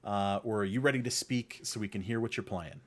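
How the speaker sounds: usual speaking voice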